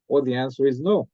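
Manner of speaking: ordinary delivery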